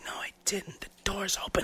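faint whispered denial; imitating a sleepy whisper. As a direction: whisper it, barely there.

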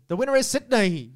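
put-on ceremonial announcer; Olympic announcer. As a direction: proclaim it like an Olympic host-city announcement. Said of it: "That was from the Olympics".

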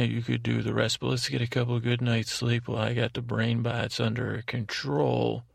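ordinary talk, nothing marked

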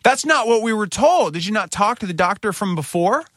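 a parent furious at the changed plan; retold indignation. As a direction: pitch it way up in angry disbelief. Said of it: "And I'm like".

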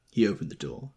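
ordinary delivery, nothing marked

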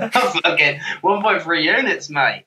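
hammed-up Australian accent, breaking out of a laugh; mock Australian accent. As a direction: do a jokey Aussie accent. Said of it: "I didn't know you did accents".

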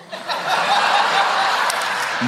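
a high, airy burst of laughter; real amused laugh; high breathy laugh. Real, not acted. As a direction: laugh at your own outrageous line. Laughs at his own remark.

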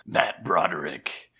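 monster reveal. Acted. Boogeyman reveals himself.

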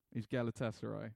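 normal talking voice

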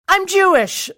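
high, loud cracking-under-pressure confession; panicked blurt. Retold or imitated. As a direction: blurt it out like a kid caving in.

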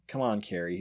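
calm her down softly; soothing. Acted. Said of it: "he said soothingly".

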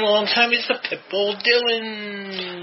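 slow, drawn-out ring introduction; announcer voice. Acted. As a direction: stretch it out like a boxing announcer.

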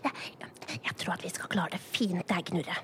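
hushed whisper while planning. Pretend. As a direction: whisper it so nobody hears. Sharing a secret plan.

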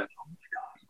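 awed little whisper. Retold or imitated. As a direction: breathe it out in stunned awe. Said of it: "and I was just like".

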